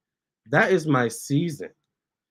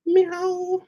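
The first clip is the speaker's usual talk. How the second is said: meow imitation. Meowing at her cats.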